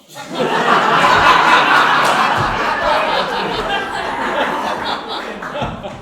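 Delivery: long, very loud laughing fit; real roaring laugh; uproarious laughter. Real, not acted. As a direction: burst into helpless loud laughter. Table erupts at bad roll.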